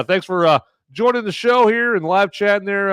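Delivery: ordinary talk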